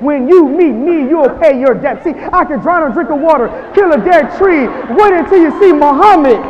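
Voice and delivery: loud, high boxer's brag; Muhammad Ali boast. Retold or imitated. Motivational speaker doing Ali's boast for the crowd, loud and high. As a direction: brag like the champ, big and loud. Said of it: "Muhammad Ali would do it just like this".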